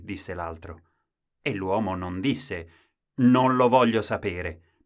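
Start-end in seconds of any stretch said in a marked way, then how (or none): 3.1-4.8 louder, slow refusal; stubborn outburst. Acted. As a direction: blurt it out stubbornly. Man's refusal voiced.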